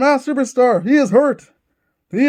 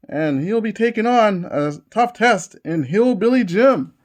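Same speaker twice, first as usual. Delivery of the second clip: normal talking voice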